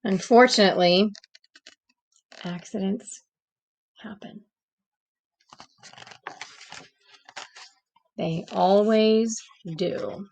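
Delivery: gentle read-aloud storytelling voice; story narration. A narrator's reading. Teacher reading a storybook to the class, gentle narration. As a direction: read it like a bedtime picture book.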